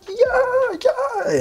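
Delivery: very high falsetto squeal; hammed-up, squealing mock celebration; going crazy. Acting out her reaction to the verdict, going crazy. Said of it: "she's just going crazy".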